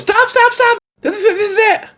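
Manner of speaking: put-on high-pitched frantic kid cry; boy yelling. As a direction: squeal it as an excited little boy. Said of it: "he yells".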